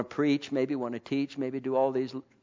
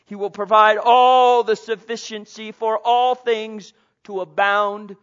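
loud, high preaching climb; preaching at full force; 8 semitones higher, 11 dB louder than usual. Acted. Preacher rousing the congregation, loud and high. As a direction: lift the congregation with fervent preaching.

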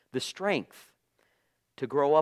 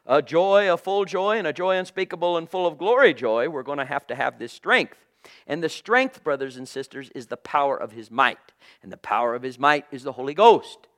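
louder, higher, fervent preaching; preaching at full force; 3 semitones higher, 7 dB louder than usual. Acted. Preacher stirring brothers and sisters, full-force fervour. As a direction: drive it home to the congregation.

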